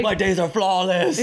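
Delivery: high, lightly voiced mock of a smug driver; mock grump. Mocking the driver's boast.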